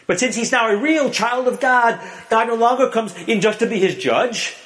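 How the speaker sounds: usual speaking voice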